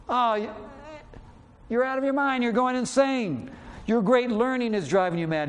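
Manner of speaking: mocking governor. Preacher voices Festus mocking Paul.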